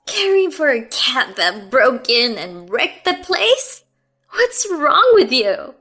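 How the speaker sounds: high, loud, sharp rebuke. A narrator's reading. Mother-in-law rebuking Heather for caring for cat, high and sharp. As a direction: cut her down. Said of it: "Her voice was sharper than ever".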